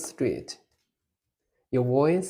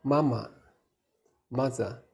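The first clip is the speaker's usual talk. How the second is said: ordinary talk, nothing marked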